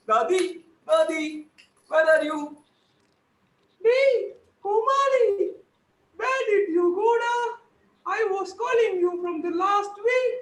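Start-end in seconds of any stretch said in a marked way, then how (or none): 3.8-10.3 granny calling. Acted. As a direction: scold him for hiding all week. Plays granny.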